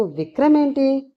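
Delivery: high, puzzled question; bewildered. A narrator's reading. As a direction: ask it in confusion, voice lifted. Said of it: "అయోమయంగా చూస్తూ".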